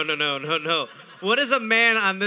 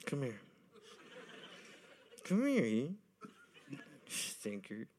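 soft coaxing; 6 semitones lower, 15 dB quieter, slower than usual. Pretend. Playing John, soft.

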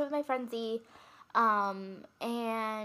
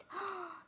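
quick surprised gasp; sharp gasp; 9 semitones higher, 9 dB quieter than usual. Real, not acted. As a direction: gasp at the thought. Sudden realisation.

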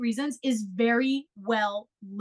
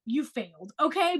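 ordinary talk, nothing marked

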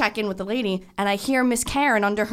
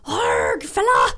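higher and louder, a gruff wrestler yell on "fella"; Sheamus impression; 6 semitones higher, 3 dB louder, much slower than usual. Imitating the wrestler Sheamus's catchphrase for his friend. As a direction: bark it out like the wrestler would. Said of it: "I'm like the wrestler Sheamus".

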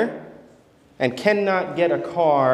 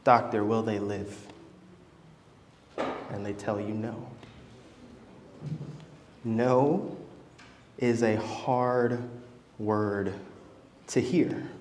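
much softer and lower, slow and heavy; grave, quiet; 8 semitones lower, 11 dB quieter than usual. Acted. Preacher telling his congregation of a loved one dying, hushed and slow. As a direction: let each word sink as bad news lands.

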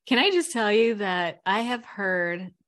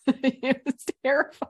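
laughing high through the words; 12 semitones higher, 8 dB louder than usual. Real, not acted. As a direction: laugh it out, half shrieking. Laughing remembering terrifying moment.

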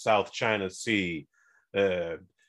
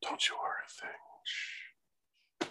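secretive whisper ending in a shush; hushed whisper; 13 dB quieter, slower than usual. Pretend. Hushing the co-host's questions about the money, whispering.

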